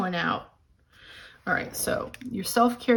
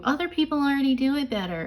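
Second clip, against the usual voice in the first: quick, higher nagging inner voice; comparison creature; 6 semitones higher, faster than usual. Retold or imitated. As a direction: play the sneering inner critic. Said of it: "Comparison creature tells you".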